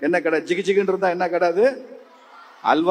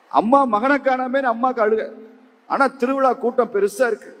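plain reading voice

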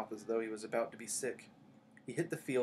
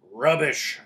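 higher, booming dismissal; loud dismissal; 4 semitones higher, 15 dB louder than usual. A narrator's reading. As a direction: shout down the idea as Hagrid. Hagrid rejects the accusation again.

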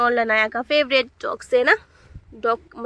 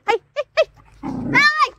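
sharp high-pitched alarm; startled cry; 14 semitones higher, slower than usual. Real, not acted. Mother alarmed as Pogo rushes the puppies, high cry. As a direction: squeal as the big dog appears.

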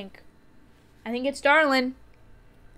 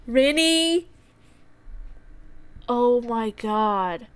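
usual speaking voice

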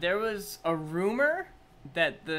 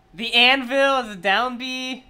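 raised pitch and volume; excited reaction; 5 semitones higher, 8 dB louder than usual. Real, not acted. Fan spotting each new move, excited reaction. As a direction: call out each discovery loudly.

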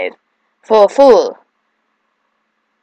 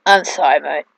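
normal talking voice

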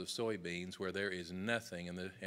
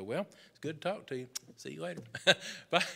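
dismissive brush-off; 7 semitones higher, 4 dB louder, faster than usual. Retold or imitated. Brushing off lecturing client.

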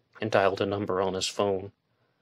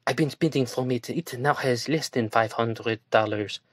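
woman's voice; 4 semitones higher than usual. A narrator's reading. Woman pleading in story.

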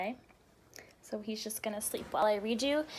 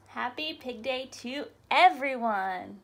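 ordinary delivery, nothing marked

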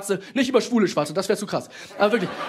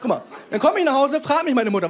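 ordinary talk

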